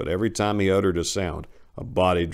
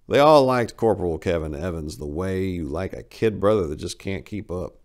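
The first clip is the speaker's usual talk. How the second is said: ordinary talk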